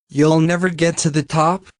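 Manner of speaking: ordinary talk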